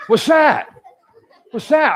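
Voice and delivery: high alarmed yelp of surprise; startled cry. Acted. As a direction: shout it like you've never seen a line. Startled caveman at the line.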